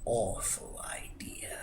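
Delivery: hushed and slow, savoring the evil; a slow, sly storyteller's whisper; slow dramatic whisper. A narrator's reading. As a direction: whisper it like a dark secret.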